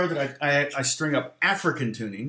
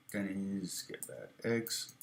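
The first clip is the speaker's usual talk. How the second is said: slow, much softer spell-out; 5 semitones lower, 14 dB quieter, much slower than usual. Shown as a demonstration. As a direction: say it slowly under your breath. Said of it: "Sorry, Denny's".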